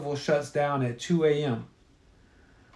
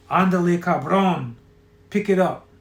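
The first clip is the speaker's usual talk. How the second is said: louder, mildly commanding call; barked order; 3 semitones higher, 4 dB louder than usual. A narrator's reading. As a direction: toss out a brusque order. Trent orders Hector around.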